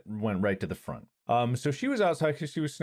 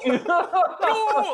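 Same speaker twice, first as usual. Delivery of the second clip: loud high wail; mock-distressed howl on one long word; imitated dog howl; 6 dB louder than usual. Owner showing how the dog sounded, howling wail. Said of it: "literally sounded like her going".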